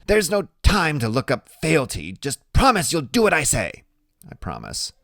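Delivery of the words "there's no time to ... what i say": put-on high, brisk know-it-all boy; a small genius giving orders; brainy small boy. A narrator's reading. Boy hurrying his big friend along, bossy and high. Freak's voice in the narration.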